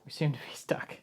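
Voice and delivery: whispered to himself; breathy whisper under his breath. Real, not acted. Stuck part, muttering to himself.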